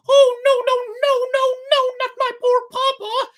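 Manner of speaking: very high, loud puppet voice, pleading in despair; high loud puppet voice crying in despair. A narrator's reading. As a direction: beg for his father's life like a terrified child. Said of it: "cried Pinocchio in a despairing voice".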